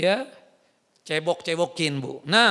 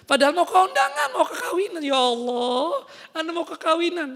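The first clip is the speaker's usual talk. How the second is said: whiny lament; 7 semitones higher than usual. Retold or imitated. Sick wife whining at husband.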